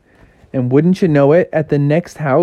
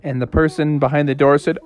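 plain reading voice

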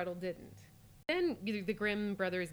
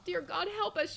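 pleading exclamation; 8 semitones higher than usual. A narrator's reading. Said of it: "she exclaimed".

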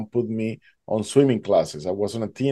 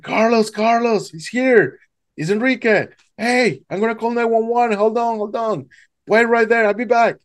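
friend calling out; 11 semitones higher than usual. Retold or imitated. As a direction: call down to him across the water. Friend shouting from the hill.

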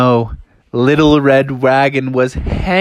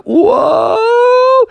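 a squealed whoa in midair; bears flying over the rock; high thrilled whoa; 4 dB louder than usual. Acted. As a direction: whoop it high and loud.

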